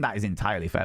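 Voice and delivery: normal talking voice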